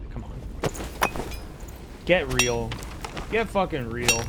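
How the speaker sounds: ordinary talk, nothing marked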